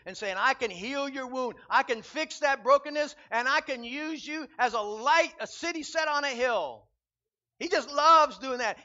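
loud, rising pulpit preaching; stirring the church to bring people in, loud sermon; full-force preaching. Acted. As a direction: preach it out to the whole room.

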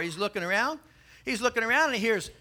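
usual speaking voice

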